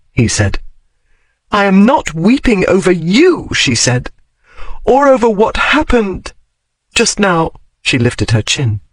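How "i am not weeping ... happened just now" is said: higher, prim woman's denial; Daphne's voice. A narrator's reading. Daphne tells him it's not about him, higher and prim. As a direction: deny it with lifted chin.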